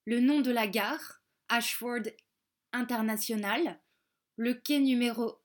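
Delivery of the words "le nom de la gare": ordinary delivery, nothing marked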